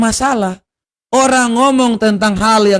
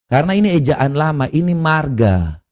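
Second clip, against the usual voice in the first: usual speaking voice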